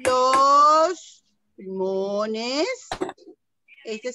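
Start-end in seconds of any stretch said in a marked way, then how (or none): 0.1-2.7 very slow. Shown as a demonstration. Drawn out video word.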